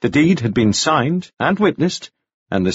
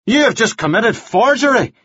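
high-pitched outraged reproach; angry accusation; 11 semitones higher than usual. A narrator's reading. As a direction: accuse her hotly, pitch raised. Devlin's angry accusation continues.

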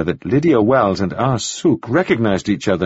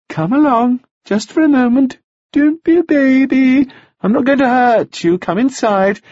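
put-on much higher, louder, coaxing like to a child; coaxing widow; 4 dB louder than usual. A narrator's reading. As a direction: cajole him in, talking down. Mrs Wells coaxes him.

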